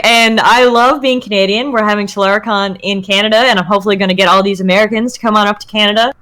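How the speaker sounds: ordinary delivery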